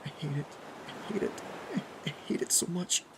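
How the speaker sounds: soft, high, breaking voice; terrified whimper. A narrator's reading. Kathy in frozen shock, whimpering.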